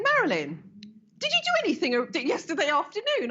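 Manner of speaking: bursting to know what she did; excited caller. Retold or imitated. Granddaughter calling the healer the next day, excited.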